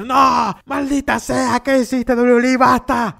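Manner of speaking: high-pitched, hammed-up exasperated outburst; mock outraged rant. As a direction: act betrayed by WWE, wailing it out way up high. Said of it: "Me hubiese gustado decir".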